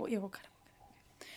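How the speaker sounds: hushed, sinking to a bare whisper; conspiratorial whisper. Pretend. Agreeing to cut the slip, whispered. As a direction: agree under your breath.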